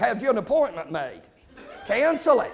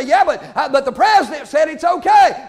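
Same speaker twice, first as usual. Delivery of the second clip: louder mock churchgoer whining an excuse; 7 semitones higher, 10 dB louder than usual. Preacher voicing a listener's excuse about the president, mock protest. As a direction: throw the excuse back at them. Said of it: "And that you say".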